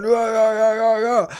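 a lighter answering shout of no; second voice shouting. Retold or imitated. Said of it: "someone else was going".

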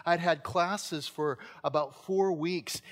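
ordinary delivery

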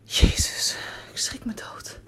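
frightened whisper after a jolt; startled whisper. Acted. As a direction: gasp it out in a scared whisper. Startled by a slamming door.

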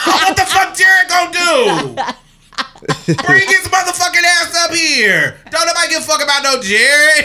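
high, loud belligerent rant; retold angry shout. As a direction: holler it like a hammed-up dare. Repeats angry comeback, laughing around it.

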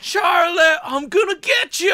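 high shouted taunt; mock villain shout. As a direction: yell it like a cartoon villain. Imitating movie villain shouting.

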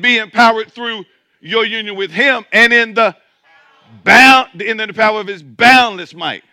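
loud, high preaching build; full-force preacher calling out the verse. Acted. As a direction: lift the church with a rising shout. Preaching climax.

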